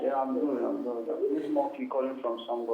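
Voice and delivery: phone line voice; over the phone. Real, not acted. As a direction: caller through a phone.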